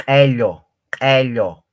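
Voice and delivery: ello with a popping, explosive k; ejective k sound. Shown as a demonstration. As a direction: burst the k of ello like something popping. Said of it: "como si ... explotar algo".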